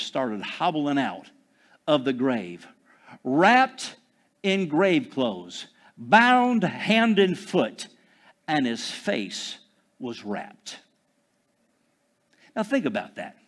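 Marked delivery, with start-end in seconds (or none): none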